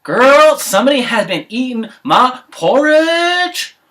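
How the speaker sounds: high, loud, slow sassy outrage; sassy mom bear. Acted. Mom bear finding her porridge eaten, loud and sassy. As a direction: call it out like a furious auntie. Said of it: "the mom bear was like".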